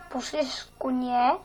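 ordinary talk, nothing marked

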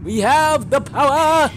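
high, loud, drawn-out battle cry; triumphant shout. Pretend. Gamer claiming a power, triumphant shout. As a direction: belt it out like a cartoon hero.

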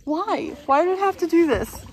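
high, loud panicked wail; screaming at a spider. Real, not acted. Spider in her kayak.

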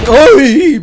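sudden high, loud yelp of fright; real jumpscare shriek; startled yelp. Real, not acted. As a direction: jump out of your skin.

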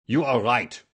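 loud, agitated outburst; crying out. A narrator's reading. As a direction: exclaim it forcefully as an exposed nobleman. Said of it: "he cried".

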